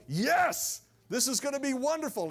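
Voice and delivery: high, loud burst of excitement; unembarrassed delight. Acted. Honored guest refuses to tone it down, loud and thrilled. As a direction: burst out gleefully on YES. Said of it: "he's not embarrassed going".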